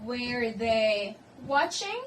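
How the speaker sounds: slow question, "watching" climbing at the end; spaced words with a lift on "watching"; rising question intonation. Shown as a demonstration. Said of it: "the intonation will rise at the end".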